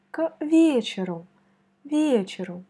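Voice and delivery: вечеру stressed on its first syllable, ВЕчеру. Shown as a demonstration. Teacher shows the student where stress falls. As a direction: lean on the first vowel of вечеру. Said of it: "c'est la première I qui est frappée".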